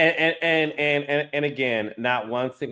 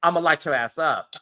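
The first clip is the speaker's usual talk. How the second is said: imitating tough woman; 2 semitones higher, 5 dB louder than usual. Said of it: "she's sort of like".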